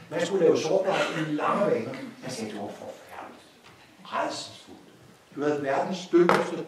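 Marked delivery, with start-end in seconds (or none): 2.2-4.8 hushed, horrified whisper. Acted. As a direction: breathe it out as if it's too awful to say aloud. Repeats line, now whispered. (hvisket forfærdet)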